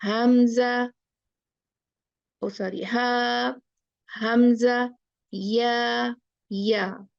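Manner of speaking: each letter name drawn out, slowly; slow alphabet recitation. Shown as a demonstration. Teacher slowly reciting the alphabet to students.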